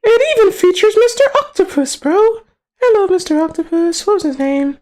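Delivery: very high squeaky voice; playful falsetto. Pretend. Greeting Mister Octopus from the story, playful falsetto.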